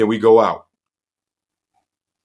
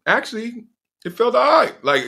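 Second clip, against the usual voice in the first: ordinary talk, nothing marked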